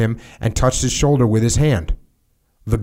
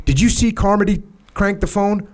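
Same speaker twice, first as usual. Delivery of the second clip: higher-pitched, pressing officer's demand; angry superior; 9 semitones higher than usual. A narrator's reading. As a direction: read the colonel's anger pitched up. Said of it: "immediately began screaming".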